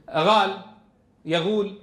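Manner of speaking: "غال" and "يغول" with gh replacing q; Sudanese qaf as ghayn. Shown as a demonstration. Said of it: "القاف غينا". (نطق "غال، يغول" بالغين بدل القاف)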